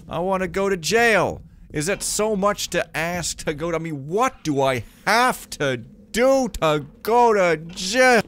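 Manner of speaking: loud, high, whining demand to be jailed; frustrated shouting at the game. Real, not acted.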